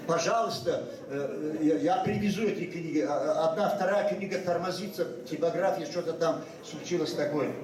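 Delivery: ordinary talk, nothing marked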